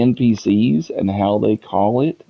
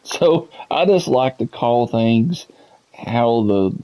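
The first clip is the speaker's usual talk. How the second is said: ordinary talk, nothing marked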